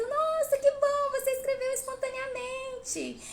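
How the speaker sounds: high, loud gushing praise; mock applauding praise. Parent gushing over a misspelled word, high mocking praise. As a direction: overdo the delight, parodying a clapping parent. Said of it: "E depois você aplaudindo".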